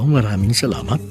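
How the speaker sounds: ordinary delivery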